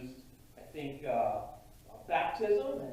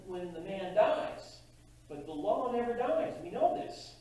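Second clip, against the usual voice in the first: ordinary talk, nothing marked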